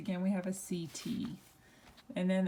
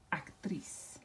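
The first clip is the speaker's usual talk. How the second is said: softer repeat of actriz, weight on "triz"; stress on last syllable; 3 semitones lower, 7 dB quieter than usual. Shown as a demonstration. Teacher repeats the word for class, softly, actriz stressed at the end. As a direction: actriz in Spanish, final "triz" stressed, voice dropped. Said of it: "we would pronounce that".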